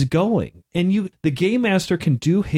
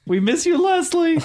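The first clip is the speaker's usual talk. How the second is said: mock mournful tribute; 13 semitones higher than usual. Jokey tribute, Airplane joke.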